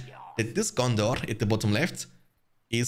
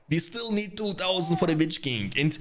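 normal talking voice

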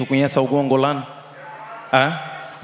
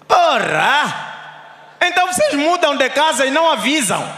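grandpa's outburst; 12 semitones higher than usual. Retold or imitated. Grandfather back after seven years, scolding family in a high shout. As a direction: play the grandpa, outraged that nobody told him. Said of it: "Sabe o que que o meu avô disse?".

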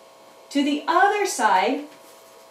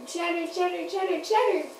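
puppet voice; 5 semitones higher, 7 dB quieter than usual. Pretend. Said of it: "they said".

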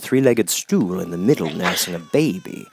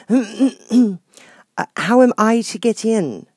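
Alice; 14 semitones higher, 6 dB louder, slower than usual. Acted. Alice asks again.

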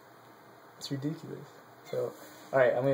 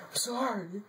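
high, softer put-on apology; mock cowed husband; 9 semitones higher, 6 dB quieter than usual. Husband apologizing to beating wife, small high mock voice. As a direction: imitate a meek husband, pitched way up and quiet. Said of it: "It's like".

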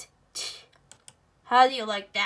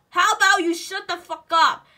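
usual speaking voice

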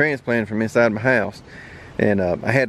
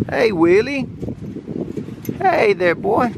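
high sing-song voice for the dog; talking to a dog; 11 semitones higher, slower than usual. Real, not acted. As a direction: coo at the puppy, pitch way up. Greeting his puppy dog.

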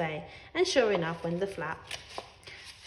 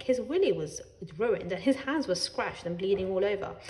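plain reading voice

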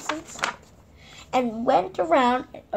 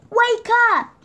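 higher, louder wake-up call; cry; 11 semitones higher, 10 dB louder than usual. Acted. Mister Silly waking his sleeping friend, loud high cry. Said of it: "cried Mister Silly".

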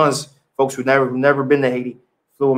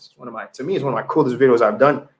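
ordinary talk, nothing marked

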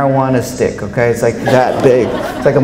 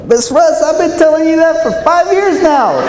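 choked-up high plea, not quite crying; tearful, pitched up; 14 semitones higher than usual. Retold or imitated. Speaker tearing up, telling his teacher again, high tearful voice. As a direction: voice climbing high while tearing up. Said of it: "And I'm tearing up".

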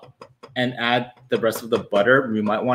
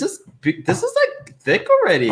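surprised squeal. Real, not acted. Stirring thick cheesy grits.

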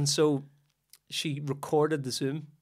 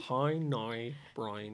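low, quiet try at a Derry accent; Derry accent, tentative and soft; Derry accent attempt; 6 semitones lower, 11 dB quieter, much slower than usual. Shown as a demonstration. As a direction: warm up a Derry accent under your breath. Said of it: "Is that your Derry accent?".

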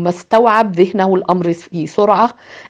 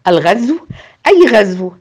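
rising louder alarmed questions; a frightened girl demanding answers; 3 dB louder than usual. Acted. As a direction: ask in sudden panic. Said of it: "في ذعر".

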